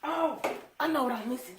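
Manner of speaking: light up with a sudden idea; sudden realization. Real, not acted. Cook realizing an ingredient is missing, surprised.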